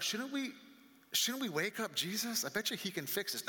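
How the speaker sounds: high-pitched, tentative acted plea; hopeful suggestion. Disciple urges the others to wake Jesus, hopeful. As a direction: pitch it up as an eager proposal.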